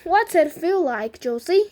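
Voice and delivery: storybook grandpa, excitedly curious; excited question. A narrator's reading. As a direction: ask as if bursting to know. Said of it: "asked Grandpa Joe excitedly".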